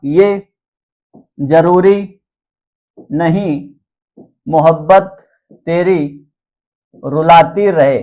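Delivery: one word at a time, paused between; slow dictation. Shown as a demonstration. Teacher dictating a mnemonic as students write, slow dictation. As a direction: spell out the phrase word by word for note-takers. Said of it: "मैं धीरे धीरे करके बोल रहा हूँ".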